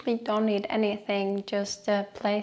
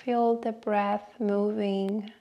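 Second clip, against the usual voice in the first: guided meditation. Acted. Host leading listeners through a short breathing meditation, guided meditation voice. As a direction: guide them unhurriedly, letting each phrase settle.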